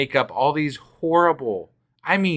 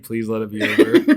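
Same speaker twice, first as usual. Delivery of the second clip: laughing; 2 semitones lower, 5 dB louder than usual. Retold or imitated. Parent's inner plea, laughing.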